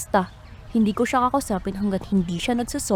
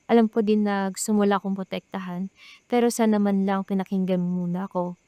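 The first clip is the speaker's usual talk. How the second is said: normal talking voice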